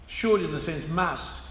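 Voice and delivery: normal talking voice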